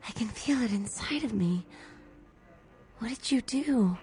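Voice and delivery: breathless frightened whisper; hushed, scared, barely voiced. Frightened after a strange kiss.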